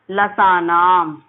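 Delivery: लसानाम stretched very slowly with a falling end; slow recitation. Shown as a demonstration. Teacher slowly reciting the sutra for students. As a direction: drag out लसानाम syllable by syllable. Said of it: "बोलने की कोशिश कीजिए".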